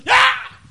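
high shout; ecstatic cry. Retold or imitated. Vernie shouting in the deathbed.

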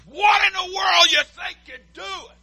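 high, shouted outrage; indignant shout. Shown as a demonstration. Preacher showing true indignation to congregation, shouting. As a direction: blast the indignant challenge. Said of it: "See, I can be indignant too".

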